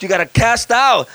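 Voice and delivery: loud, high, rising to full force; preaching shout. Real, not acted. Preacher shouting the church into action. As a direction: build the preaching to a roar.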